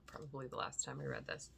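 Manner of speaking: ordinary delivery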